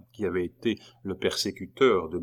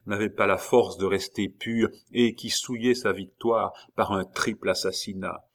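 ordinary delivery, nothing marked

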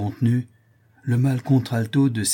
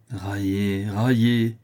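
bitter taunt; much slower than usual. A narrator's reading. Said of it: "Je ne raille pas".

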